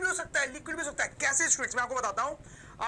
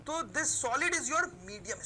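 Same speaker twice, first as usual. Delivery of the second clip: slow dictation; much slower than usual. Shown as a demonstration. Dictating a line while writing.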